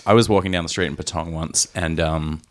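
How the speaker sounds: ordinary talk, nothing marked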